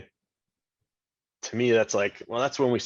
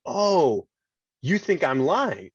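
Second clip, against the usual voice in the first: higher, startled realization; sudden realization; 5 semitones higher, much slower than usual. Retold or imitated. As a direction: voice jumps up as it dawns on you.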